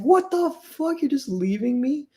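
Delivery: high, shrill disbelieving cry; donkey-faced woman crying out at her fiance as he leaves her; shocked outrage. Retold or imitated. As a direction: squeal it like you can't believe he's leaving. Abandoned woman cries out.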